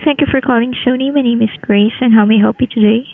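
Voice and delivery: normal talking voice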